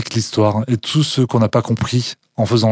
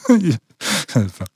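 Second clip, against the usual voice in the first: laughing; 5 semitones higher, 4 dB quieter, faster than usual. Real, not acted. Laughs at hard question.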